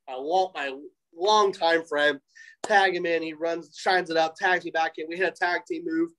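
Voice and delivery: ordinary delivery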